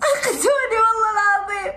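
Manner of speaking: high sobbing wail; crying. Real, not acted. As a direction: cry out the plea, voice breaking. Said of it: "والله ما قصدي أبكي".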